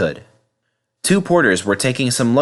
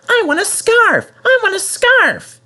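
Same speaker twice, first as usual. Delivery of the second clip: much higher, whiny little engine chant; Percy nagging; 4 dB louder than usual. Acted. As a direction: nag and repeat it like a spoilt child.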